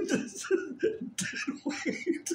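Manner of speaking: "dead weight" squeezed out breathless and high through laughter; helpless laughing under the dog. Real, not acted. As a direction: giggle the words "just dead weight" almost voiceless.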